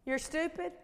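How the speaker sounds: lightly voiced sneering accuser; a hint of a taunting voice; accusing inner voice. Preacher voicing the enemy's lies to her congregation, lightly accusing. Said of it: "Y'all ever hear this voice?".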